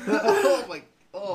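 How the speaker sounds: high laughing cry of disgust. Real, not acted.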